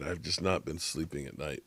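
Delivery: ordinary talk, nothing marked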